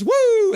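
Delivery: high, loud fan's whoop. Retold or imitated. Voicing a chatter's cheer, a loud high whoop. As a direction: let out a big high woo. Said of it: "And then Michael Chow says".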